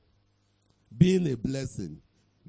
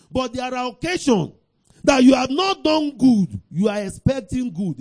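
raised, high, forceful pitch; preaching warning; 8 semitones higher, 8 dB louder than usual. Real, not acted. Preacher warning those who have not done good, forceful preaching. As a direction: press the warning on the congregation.

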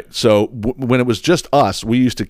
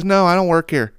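imitating the girl; 10 semitones higher than usual. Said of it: "And she goes".

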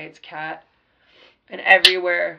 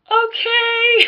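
much higher timid okay; meek crowd reply; 10 dB louder than usual. Retold or imitated. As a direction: answer like a cowed crowd. The crowd answers meekly.

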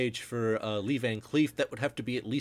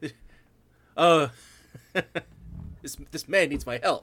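louder, higher, hurried alarm with laughs; panicked dentist; 7 semitones higher, 6 dB louder than usual. Pretend. Joking with a co-host, a dentist stopping the projector in alarm. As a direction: play a dentist jumping up mid-film.

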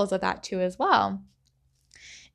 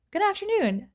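higher, bright mock store greeting; cheery greeter; 11 semitones higher than usual. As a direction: sing out "Good afternoon" like a friendly greeter. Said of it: "with a cheery face".

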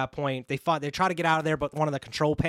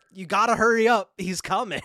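louder, higher urgent warning; mock alarm; 4 semitones higher, 4 dB louder than usual. Movie fan voices warning as killer approaches, mock alarm. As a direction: imitate a warning that danger is near.

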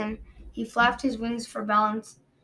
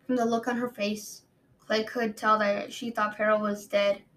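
plain reading voice

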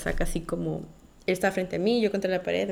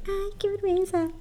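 much higher, bashful little squeal; 12 semitones higher than usual. Retold or imitated. Blushing at a boy's compliment, high bashful squeal. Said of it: "Y yo como que".

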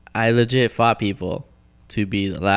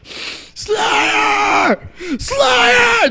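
very high, drawn-out reenacted fan scream; screaming while crying; 6 dB louder than usual. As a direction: scream the band name like a tearful fan. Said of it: "Just screaming Slayer while crying".

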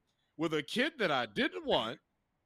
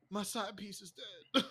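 mock mourning; 3 semitones higher, 10 dB quieter than usual. Mocking Oppenheimer's grief.

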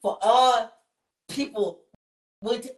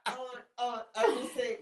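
ordinary talk, nothing marked

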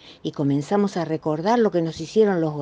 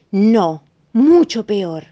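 louder, emphatic no; insistent; 7 dB louder, much slower than usual. Acted. Elena insisting to Marina it is worse, emphatic. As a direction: stress how much worse it is.

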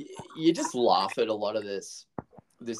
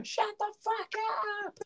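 much higher, brash yell; mock grumpy neighbour; 5 dB quieter than usual. Neighbour yelling at the greeter, mock brash. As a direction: snap it back at the greeter. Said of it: "hearing someone go".

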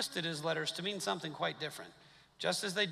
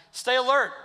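loud urgent call; 6 semitones higher, 10 dB louder than usual. Acted. Preacher quoting Peter's warning.

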